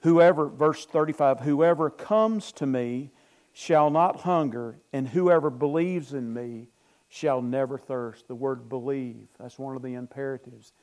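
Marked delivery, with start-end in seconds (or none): 0.0-0.5 slow, deliberate, a bit louder; scripture reading. Acted. Pastor reading John to his congregation, slow and solemn. 1.4-8.3 slow, deliberate, a bit louder; scripture reading. Acted. Pastor reading John to his congregation, slow and solemn.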